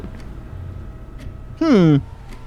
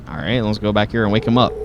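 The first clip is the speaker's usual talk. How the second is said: ordinary talk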